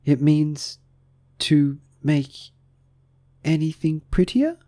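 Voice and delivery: high, halting, questioning; doubtful. A narrator's reading. Alice guessing at a definition, high and unsure. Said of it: "Alice said doubtfully".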